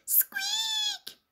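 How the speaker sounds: tiny high whispered squeaks; mouse squeak. A narrator's reading. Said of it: "And the mouse said".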